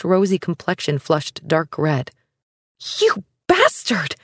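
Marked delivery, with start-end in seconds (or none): 3.0-4.2 furious hiss, pitched up. Acted. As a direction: spit it out through the teeth. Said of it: "she hissed through the delicate veil".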